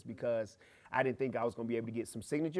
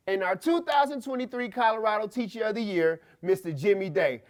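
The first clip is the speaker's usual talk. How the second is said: raised, projected stage introduction; award announcer; 6 semitones higher, 5 dB louder than usual. Retold or imitated. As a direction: announce the winner to a full auditorium. Acts out stage announcer naming winner.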